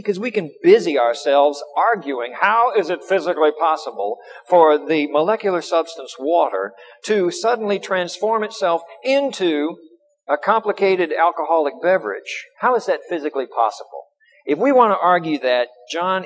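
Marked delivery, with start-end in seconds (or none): none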